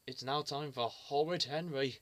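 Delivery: ordinary delivery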